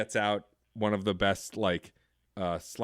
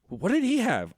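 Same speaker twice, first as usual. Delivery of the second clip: higher mock alarmed diner voice; John Candy quote; 12 semitones higher, 3 dB louder than usual. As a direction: imitate a startled diner asking. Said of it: "John Candy goes".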